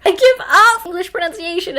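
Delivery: loud, high cry of giving up; frustrated shout, easing off; exasperated outburst. Real, not acted. Exasperated over how to say Billingham.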